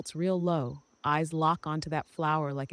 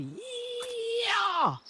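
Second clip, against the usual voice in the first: high, slow, whooping yeehaw; drawn-out yeehaw shout. Acted. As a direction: stretch the yeehaw into a holler. Cowboy whoops at end.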